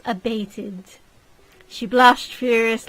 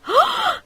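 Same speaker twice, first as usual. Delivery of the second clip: shocked gasp; 5 dB louder than usual. Acted. Ladies shocked by insult.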